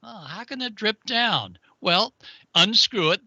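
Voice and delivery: usual speaking voice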